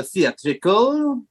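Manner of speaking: put-on slow, stretched-out French accent on "theatrical". Band member naming a word for the music, French accent. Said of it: "you have to say them in a French accent".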